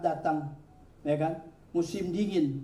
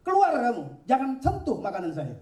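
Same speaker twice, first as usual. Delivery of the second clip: angry expulsion; 8 semitones higher than usual. Acted. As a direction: throw him out of the meal. Ibrahim throws old man out.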